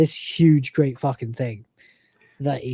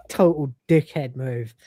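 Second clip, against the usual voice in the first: normal talking voice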